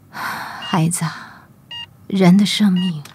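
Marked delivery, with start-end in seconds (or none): 0.1-1.5 slow, soft whispered call; tender weak whisper. Acted. Sick mother gently addressing her daughter, slow faint whisper. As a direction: draw the words out in a faint whisper.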